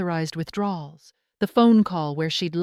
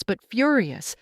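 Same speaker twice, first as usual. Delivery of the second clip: ordinary delivery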